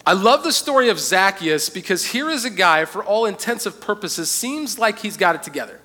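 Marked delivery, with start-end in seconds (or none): none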